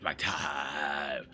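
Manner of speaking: exaggerated copy with a dragged-out "time"; mocking drawn-out imitation. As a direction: parrot it back, stretching TIME absurdly. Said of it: "saying it like that".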